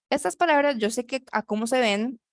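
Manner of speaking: ordinary talk, nothing marked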